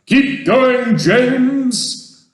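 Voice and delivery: loud, high encouragement; rousing high-pitched shout; crying out. A narrator's reading. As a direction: cheer someone on at full voice. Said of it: "they cried".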